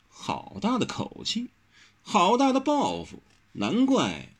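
high, disdainful mock admiration; cold scorn. A narrator's reading. Tang Qimei scoffs at such grand ambition, cold scorn. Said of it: "唐七妹冷冷哼一声道".

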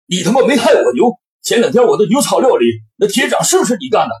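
high, loud cursing yell; furious shout. Acted. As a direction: yell it at him, fuming. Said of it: "大声地骂道".

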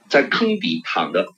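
usual speaking voice

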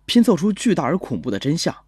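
plain reading voice

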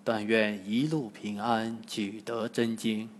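hushed prayer to Buddha; quiet prayer. A narrator's reading. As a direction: pray under your breath. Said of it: "暗暗祷告".

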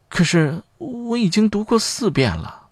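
put-on high-pitched girlish protest. A narrator's reading. Young volunteer objects to rereading for Mrs. Hartman, protesting. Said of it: "姑娘抗议地说道".

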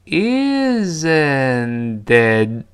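"isn't it" stretched very slowly, the last t held back; unreleased final t. Shown as a demonstration. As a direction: draw out "isn't it" in slow motion, stopping the final t without a puff. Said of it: "最后那个t憋住气失去爆破". (拖长慢读 isn't it，末尾 t 失去爆破)

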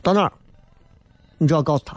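usual speaking voice

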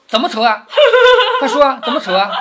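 high, loud urging broken by a big laugh; laughing prodding. Acted. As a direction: egg him on while cracking up. 众人笑着追问薛蟠. (大笑着催问)

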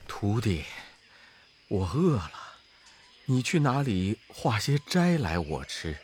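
put-on low, soft voice of an old monk; gentle monk. A narrator's reading. Master asks his disciple for food, low and soft. Tang Seng voiced in story.

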